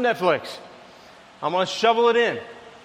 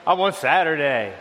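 lower, fast, grumbling complaint; whiny grumble; 6 semitones lower, faster than usual. Retold or imitated. Husband grumbling about going to community group, low and whiny. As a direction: mutter like you'd rather skip it. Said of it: "I'm like".

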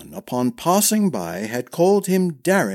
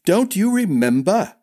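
high, loud, exasperated; annoyed at the forgetful old man; 8 semitones higher, 4 dB louder than usual. A narrator's reading. Darren exasperated.

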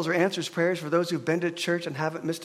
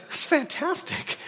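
delighted; 10 semitones higher, slower than usual. Real, not acted. Marvels at heated seats.

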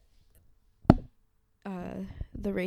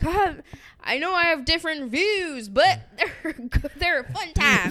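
mock conservative listener; 6 semitones higher, 4 dB louder than usual.

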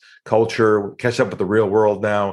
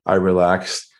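ordinary talk

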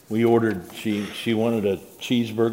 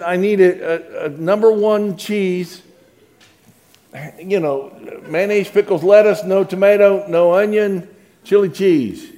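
higher and louder, halting order; rattled customer spelling it out; flustered order; 7 semitones higher, 5 dB louder than usual. Retold or imitated. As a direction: caught off guard by the clerk behind him.

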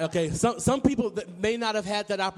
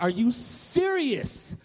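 higher, drawn-out incredulous question; amazed disbelief; 5 semitones higher, much slower than usual. Acted. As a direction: stretch it out in acted wonder. Awed child sees the buffet.

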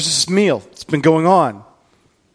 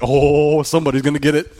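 put-on teenage John. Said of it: "fourteen-year-old John".

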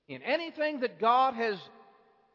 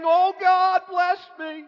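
begging cry to God; 9 semitones higher, 10 dB louder than usual. Retold or imitated. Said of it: "he's crying, he's weeping, and he's begging".

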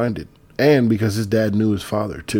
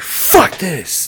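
mock furious yell, pitched up sharply; pissed-off outburst; 11 semitones higher, 5 dB louder than usual. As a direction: imitate an angry son erupting, high and loud. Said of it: "he's pissed".

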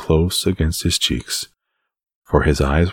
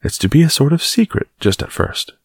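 firm; 12 semitones higher than usual. A narrator's reading. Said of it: "Mary said firmly".

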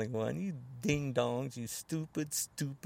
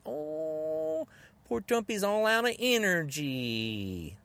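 mock pity; slower than usual. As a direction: coo over him as if he were a tired baby. Mock pity for their leader.

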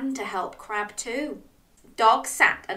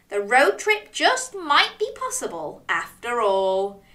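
plain reading voice

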